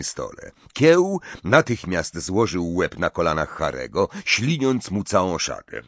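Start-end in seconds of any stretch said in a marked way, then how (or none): none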